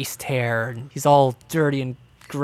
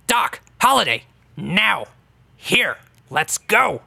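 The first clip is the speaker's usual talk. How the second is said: high, sharp summons with pauses between words; halfling calling children; 5 semitones higher than usual. Acted. Halfling calls two hesitant children to him, high and insistent. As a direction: order the children along, pitch jumping up.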